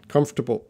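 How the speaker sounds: Comfortable as KUMF-ter-bul, three syllables, no middle t; American pronunciation. Shown as a demonstration. Teacher models Comfortable for a student. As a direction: say Comfortable clipped and quick, t dropped. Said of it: "it should be pronounced comfortable".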